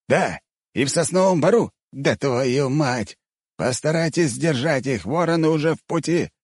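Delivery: high, urgent phone call; agitated high-pitched exclaiming; mild yell. A narrator's reading. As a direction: cry out orders high, without much volume. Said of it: "выкрикнул Вадим".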